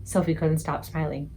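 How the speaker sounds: usual speaking voice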